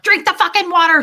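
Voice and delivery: high, loud angry order; frantic yell. Retold or imitated. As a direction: bark it like a panicked mother. Frantic mom forcing water.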